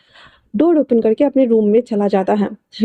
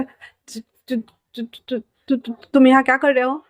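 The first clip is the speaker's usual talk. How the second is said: whispered stammering then blurted out; startled man waking beside his husband, stammering; shocked stammer; 2 semitones higher than usual. Acted. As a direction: stutter in fright, then burst out.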